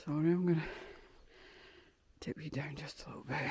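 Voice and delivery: out of breath on a steep climb; puffing between words; heavy breathing. Real, not acted. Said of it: "Sorry for the heavy breathing".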